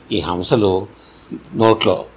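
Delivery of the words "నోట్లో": plain reading voice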